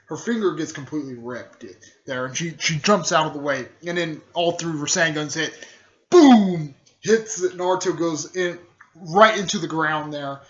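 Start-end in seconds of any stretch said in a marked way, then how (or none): 6.1-6.7 loud, high falling boom; explosion sound effect. Retold or imitated. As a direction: boom it out like a blast. Imitated explosion.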